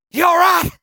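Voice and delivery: high, loud call across the pen; hollered concern; worried shout. Retold or imitated. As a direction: yell out to check on a downed friend. Buddies run up to fallen rider.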